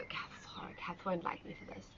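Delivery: a playful whisper, half to herself; whispered aside. Real, not acted. Teacher whispering a joke about Kath during the stretch, whispered. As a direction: let the class overhear a cheeky secret.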